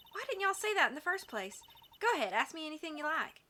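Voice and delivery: high, eager Southern voice; Southern accent. Acted. Witness keen to talk about a murder, eager Southern accent. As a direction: welcome questions in a lively Southern accent. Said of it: "maybe I should give her a Southern voice".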